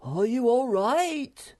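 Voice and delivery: high, loud, drawn-out call; worried shout. A narrator's reading. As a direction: call down anxiously to someone fallen. Moomin Troll calls to fallen friend.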